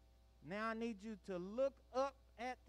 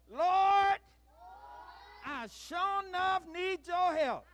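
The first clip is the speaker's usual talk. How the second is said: high, full-force shouted plea; emphatic shout; 9 semitones higher, 8 dB louder, slower than usual. Shown as a demonstration. Leader urging children to pray with feeling, shouting emphatically. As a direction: belt it out with real gusto to fire them up. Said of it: "We gotta say it with some".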